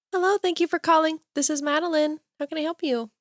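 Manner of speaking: high, chirpy phone greeting; customer service voice. Acted. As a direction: pitch it up, eager to help callers. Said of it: "When it's customer service".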